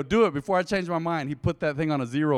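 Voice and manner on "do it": loud, urgent retold order; urgent command. Man orders friend to shave his head, urgent command. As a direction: punch it out before nerve fails.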